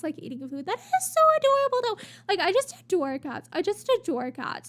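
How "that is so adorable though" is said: high, loud, quick cooing; real fast burst of delight; delighted gushing. Real, not acted. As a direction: gush over something adorable. Gushing over cat picture.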